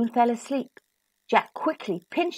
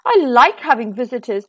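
loud giant laying down the law; big, emphatic giant voice; commanding giant; 8 dB louder than usual. A narrator's reading. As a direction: boom the order, slowing hard at the end. Giant orders Jack to bathe.

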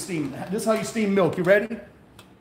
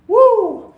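excited woo; 8 dB louder than usual. Real, not acted. Hyped shout after espresso shot.

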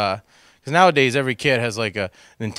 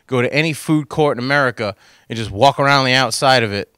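ordinary talk

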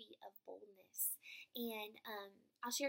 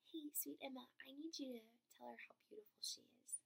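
gentle whisper; 13 dB quieter than usual. Retold or imitated. Voices God's gentle words.

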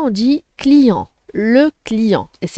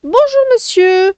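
higher, lightly voiced lady customer greeting; teacher voicing the customer's greeting, higher; 10 semitones higher than usual. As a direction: play the customer saying hello, pitched up. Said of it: "à toi de retenir ces deux premières répliques".

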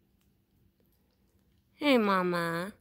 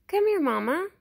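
higher, louder sing-song call to an animal; coaxing pet call; 7 semitones higher, 7 dB louder than usual. Real, not acted. Vlogger calling Mama and hushing the cats, coaxing loud call. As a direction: coax the shy animal closer, calling out.

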